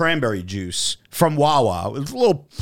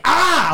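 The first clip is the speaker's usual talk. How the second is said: sharp mock yelp of pain; retold cry of pain at the needle; pained yell; 5 dB louder than usual.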